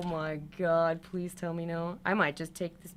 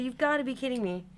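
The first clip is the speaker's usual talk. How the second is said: normal talking voice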